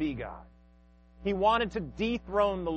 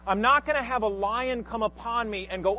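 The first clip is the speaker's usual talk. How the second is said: ordinary talk, nothing marked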